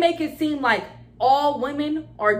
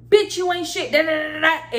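higher, louder mock insult; mocking an angry man; 5 semitones higher, 5 dB louder than usual. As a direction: spit it out like a bitter troll. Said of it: "your thing to go to is".

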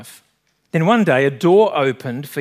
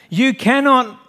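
high-pitched, protesting storyteller's cry; shrieked; 7 semitones higher, 5 dB louder, slower than usual. A narrator's reading. Stranger to the man who will let go, shrieking. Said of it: "the stranger shrieked".

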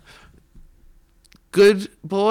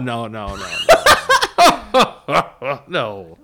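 Santa laugh; 3 semitones lower, much slower than usual. Pretend. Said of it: "That was a pretty good Santa laugh".

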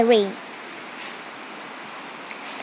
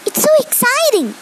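mildly excited, higher pitch; 9 semitones higher than usual. A narrator's reading. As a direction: read it with a child's wonder, lifted pitch. Child thrilled inside magic world.

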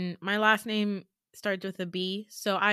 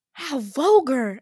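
loud, high, drawn-out mock outrage; scandalized, slow and raised in pitch; shocked; 5 semitones higher, 8 dB louder, slower than usual. Audience shocked at the student's swearing, hammed-up shock. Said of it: "they're, like, shocked".

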